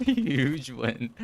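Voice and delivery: amused chuckle; laughing. Real, not acted. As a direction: laugh while saying it. Laughing at teammate's blunder.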